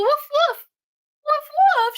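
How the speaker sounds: high mock barking; imitated woof. Answering the puppy's growl with high mock woofs. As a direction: bark back at the puppy playfully.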